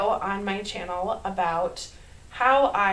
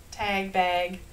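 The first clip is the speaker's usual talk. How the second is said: Minnesota accent. Shown as a demonstration. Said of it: "Can you hear my Minnesota accent?".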